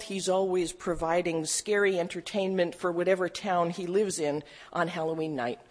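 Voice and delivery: ordinary talk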